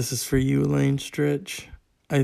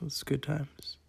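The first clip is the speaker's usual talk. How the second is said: "good times" repeated much softer, as an aside; much softer echo; 11 dB quieter, slower than usual. Shown as a demonstration.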